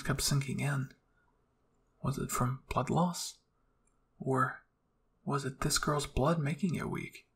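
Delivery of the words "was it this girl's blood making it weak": plain reading voice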